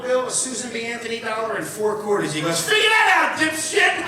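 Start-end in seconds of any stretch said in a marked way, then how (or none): 2.6-4.0 loud, high jeering challenge; mocking shout, pitched up; triumphant taunt. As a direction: throw it at the kid like a dare. Father challenges the young cashier.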